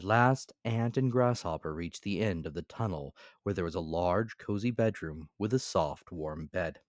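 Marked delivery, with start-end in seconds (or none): none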